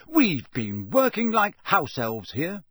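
play-acted grievance; mock outrage. A narrator's reading. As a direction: protest as if deeply wronged. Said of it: "said Ron in mock outrage".

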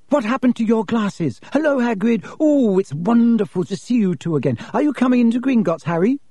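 high, eager schoolgirl chatter; excited girl. A narrator's reading. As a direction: gush happily on meeting friends. Excited girl greets her two friends.

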